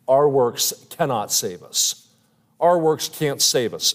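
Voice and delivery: "our works" stressed, spoken slowly; slow emphasis. Shown as a demonstration. Pastor repeating the key doctrine to the congregation, slowly. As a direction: drive home "our works can't save us" deliberately. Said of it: "repeating the key point".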